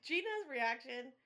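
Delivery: ordinary delivery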